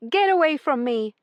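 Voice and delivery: high, loud angry snarl. A narrator's reading. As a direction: snarl him away. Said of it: "she snarled".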